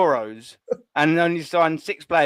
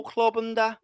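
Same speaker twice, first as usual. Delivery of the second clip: mock Scouse accent; 3 semitones higher than usual. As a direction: put on a hammed-up Liverpool accent. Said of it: "Is that a Scouse accent?".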